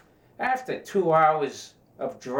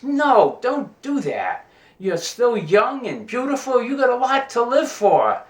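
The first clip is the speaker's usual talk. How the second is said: higher, louder eager sailor, hammed-up; sailor's voice; 9 semitones higher, 4 dB louder than usual. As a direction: pitch him up as a keen young sailor pleading.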